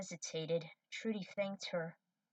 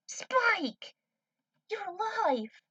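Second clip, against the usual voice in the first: joyful shout; 13 semitones higher, 7 dB louder, much slower than usual. Acted. Faye sees Spike alive.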